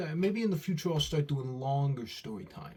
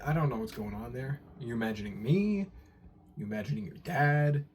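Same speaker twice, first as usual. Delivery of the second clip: ordinary talk, nothing marked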